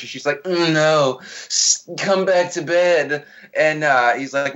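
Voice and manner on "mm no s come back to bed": lightly hammed-up sultry coaxing; mock sultry. Wife coaxing husband back to bed, mock sultry. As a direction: coax with a drawn-out, mock-seductive ending. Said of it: "oozing with weird sexuality".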